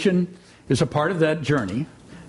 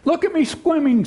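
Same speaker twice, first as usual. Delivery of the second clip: put-on much higher, tiny squeaky baby voice; squeaky Roo; 12 semitones higher than usual. A narrator's reading. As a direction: squeak it like a little joey. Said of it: "squeaked Roo".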